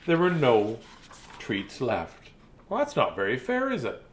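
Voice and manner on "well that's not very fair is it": plain reading voice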